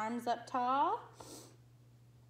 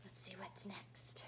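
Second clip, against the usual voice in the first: whisper; 7 semitones lower, 19 dB quieter than usual. Real, not acted. Aside while turning page.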